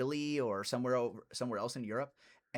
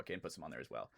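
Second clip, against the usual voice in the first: much softer, lower repeat under the breath; quiet echo; 8 semitones lower, 13 dB quieter, faster than usual. Real, not acted. Host murmurs his own line again, softly. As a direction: mutter the line again to yourself.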